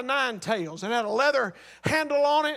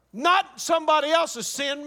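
ordinary talk, nothing marked

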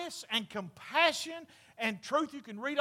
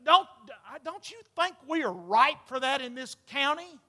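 ordinary talk

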